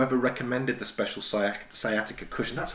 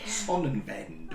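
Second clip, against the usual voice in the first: put-on German accent; 3 semitones lower, 7 dB quieter, much slower than usual. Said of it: "I'm no good with accents".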